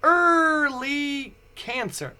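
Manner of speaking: high, very slow, dazed; stunned disbelief. Retold or imitated. Turning to wife, repeating diagnosis in slow shock. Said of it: "one more time".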